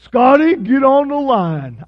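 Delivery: loud, high, drawn-out coach command; Butch. Retold or imitated. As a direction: order a student to the line, mimicking the coach. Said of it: "Coach Butch said".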